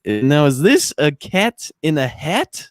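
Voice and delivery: slow, imitated foreign character voice; Borat. Retelling Borat's question about the giant turtle, in his voice. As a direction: draw it out like a puzzled question in character. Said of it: "Borat's just like".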